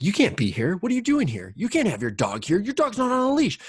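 hammed-up busybody telling him off about the dog; sneering scold of a stranger on the trail; mock scolding stranger. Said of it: "their voice comes into my head".

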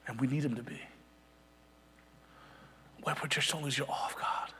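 soft, unvoiced whisper; whispered plea. Real, not acted. Quiet sermon close.

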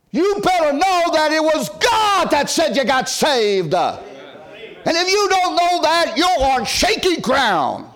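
high, loud, drawn-out preacher shout; preaching at full force. Acted. Preacher to congregation about true assurance, shouting slowly. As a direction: lift it into a slow thundering climax.

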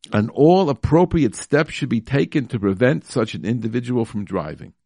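ordinary delivery

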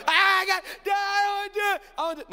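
imitating a high, hysterical wail; mock panicked screech; demon-like meltdown. Dad imitating his daughter backing out, demon-like meltdown. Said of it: "Went from daughter to demon".

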